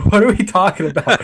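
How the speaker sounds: high, laughing repeat of the question; laughing disbelief. Real, not acted.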